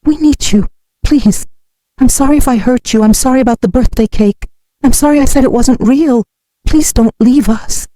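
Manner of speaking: high-pitched, mildly tearful begging; sobbing plea. A narrator's reading. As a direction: beg like an upset grandchild. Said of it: "Emma sobbed".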